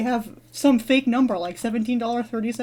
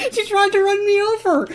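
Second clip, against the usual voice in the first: high-pitched disbelief, close to cracking up; incredulous squeal; 10 semitones higher, 3 dB louder than usual. Real, not acted. Dreamer recounting being chased by car, incredulous squeal. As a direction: squeal it in amused outrage.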